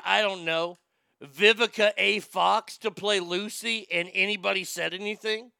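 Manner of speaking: ordinary talk, nothing marked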